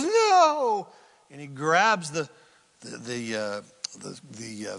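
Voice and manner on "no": a high, loud, desperate "No!"; desperate cry. Retold or imitated. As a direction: cry out to stop him from leaving. Saul pleads as Samuel turns away.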